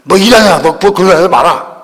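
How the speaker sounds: mock-angry rebuke, punched hard; angry mother. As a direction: imitate a mother losing her temper. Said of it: "막 화를 내시다".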